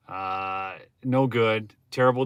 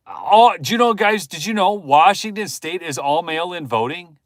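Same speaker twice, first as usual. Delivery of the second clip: much higher, loud, wide-eyed news; amazed reveal; 6 semitones higher, 6 dB louder than usual. Real, not acted. A streamer tells the guys about mail-in voting in loud amazement. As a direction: burst out with the shocking fact.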